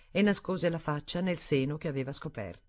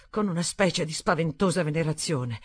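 plain reading voice

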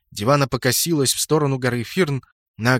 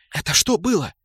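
higher, lightly voiced bewildered woman; Мираж; 5 semitones higher than usual. A narrator's reading. Mirage asks what just happened, high and startled. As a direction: ask in startled disbelief. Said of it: "затараторила рядом Мираж".

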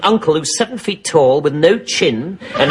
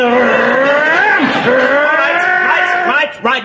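exasperated; 8 semitones higher, much slower than usual. Acted. Exasperated captain cuts him off.

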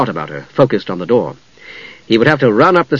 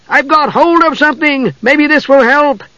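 high, eager shrill voice; shrill kender voice; 13 semitones higher than usual. A narrator's reading. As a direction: chirp it out brightly from afar. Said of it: "voice echoed shrilly".

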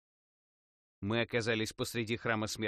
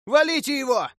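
shout; 12 dB louder than usual. A narrator's reading. Player on roof orders attack.

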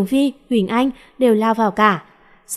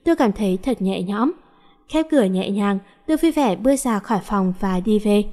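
plain reading voice

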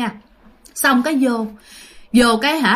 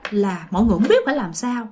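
ordinary talk, nothing marked

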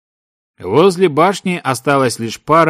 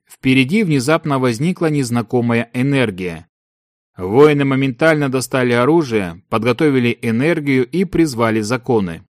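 usual speaking voice

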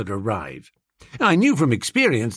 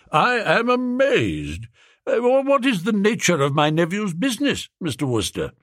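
amazed Duke; 5 semitones higher than usual. A narrator's reading. Duke astonished.

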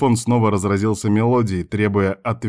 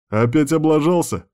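higher, mildly mocking question; snorting; 7 semitones higher than usual. A narrator's reading. Friend teasing him about another mess, scoffing. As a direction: needle him with a light scoff. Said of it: "сходу фыркнул друг".

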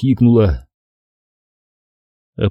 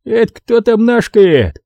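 грубый солдат; 11 semitones higher than usual. A narrator's reading. Солдат грубо спрашивает.

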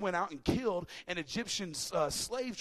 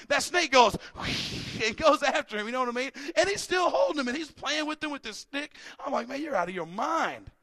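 louder, higher storytelling build; excited sermon telling; lively preaching; 8 semitones higher, 9 dB louder than usual. Acted. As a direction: sell the snake story to the crowd.

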